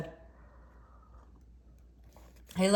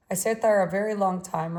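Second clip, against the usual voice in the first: ordinary delivery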